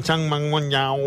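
teammates' badly faked Chinese; mock Chinese. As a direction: gibberish put on as Chinese. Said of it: "or trying to talk Chinese".

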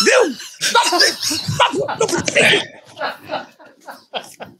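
very high, exasperated mock Chinese shopkeeper; Chinese accent. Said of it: "if you do an accent of a foreign person".

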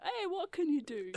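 high, light mock-Italian shrug; Italian shrug persona. Waiter playing the Italian persona for customers. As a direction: toss it off like an easygoing Italian waiter. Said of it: "do that personality of like".

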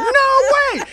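very high, delighted squeal; thrilled shriek. Retold or imitated. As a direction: play a fan freaking out. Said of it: "Lynn's freaking out".

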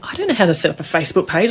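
rushed, exasperated protest. Retold or imitated. Woman protesting to God in the shower, rushed and exasperated. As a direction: blurt it out fast in disbelief.

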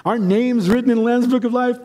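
high, sing-out mock recital; congregation chiming in. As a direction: imitate believers reciting what they all say. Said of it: "and we all say".

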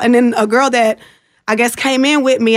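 normal talking voice

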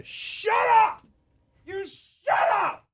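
high-pitched strained mock shout; high, strangled yell, held back in volume. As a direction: imitate an enraged coach shouting down a crowd. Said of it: "He started yelling".

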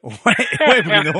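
high laughing yes. Real, not acted. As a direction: laugh through the words. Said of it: "C'est le bruit que fait une alouette".

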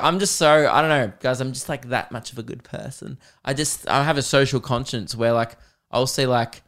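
ordinary talk

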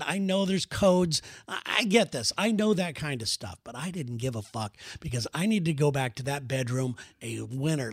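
ordinary talk

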